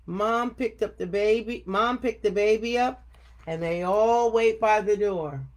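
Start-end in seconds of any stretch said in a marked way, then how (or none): none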